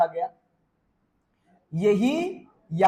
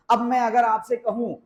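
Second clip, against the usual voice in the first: ordinary talk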